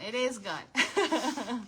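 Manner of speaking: drawn out slowly through a chuckle; slow laughing repeat. Real, not acted. Laughing to viewers that the craft hearts sell out fast, said slowly. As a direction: laugh as you stretch out the words.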